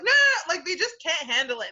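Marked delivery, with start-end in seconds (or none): 0.0-0.5 higher and louder no-no-no; mock parent refusal. Parent refusing to hear a child's feelings, high and loud. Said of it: "they're like".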